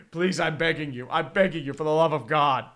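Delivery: ordinary talk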